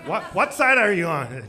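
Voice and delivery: laughing while asking her, loud; laughing challenge. Real, not acted. Comedian teasing a heckling lady, laughing loudly. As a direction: tease her through a laugh.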